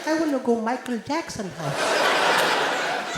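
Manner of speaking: put-on slow, drawn-out Indian kid repeating. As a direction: plead each word slowly, Indian accent. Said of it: "Indian kid".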